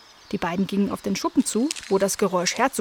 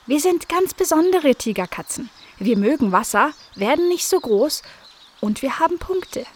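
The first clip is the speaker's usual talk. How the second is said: higher, proud little kitten voice; kitten Oki; 6 semitones higher than usual. A narrator's reading. Kitten Oki explaining what makes them special, higher. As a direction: explain proudly like a tiny tiger. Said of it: "erklärte nun Oki".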